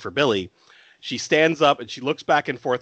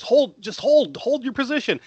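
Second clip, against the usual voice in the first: urgent order; 11 semitones higher than usual. Retold or imitated. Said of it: "And Chris goes".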